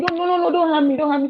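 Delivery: high-pitched frightened cub pleading; begging. Acted. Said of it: "that one was begging".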